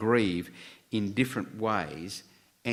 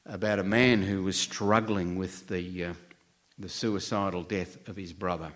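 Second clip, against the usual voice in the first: normal talking voice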